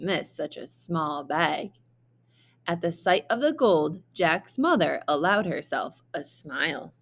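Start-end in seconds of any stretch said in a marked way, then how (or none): none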